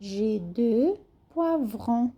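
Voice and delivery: slow J'ai deux poivrons with V and R worked in poivrons; slow careful teacher demo; slow model reading. Shown as a demonstration. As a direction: slowly model each sound. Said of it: "a gente trabalha bem o V e o R".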